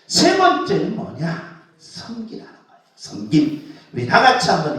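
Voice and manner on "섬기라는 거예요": whispered. Shown as a demonstration. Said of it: "pastor repeats key word softly".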